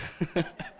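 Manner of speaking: soft, amused chuckle; real laugh. Real, not acted. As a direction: chuckle briefly at your own muddle. Laughs at his own muddle. (risatina spontanea)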